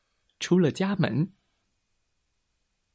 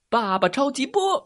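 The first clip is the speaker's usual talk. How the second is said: superhero attack cry; 9 semitones higher than usual. A narrator's reading. Daddy Superman fires a second beam, high heroic cry.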